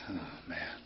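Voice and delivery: low, very soft sigh of defeat; all the wind gone out of you; deflated. Retold or imitated. Trucker just told off by a kid, deflated. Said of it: "took the wind out of my sails".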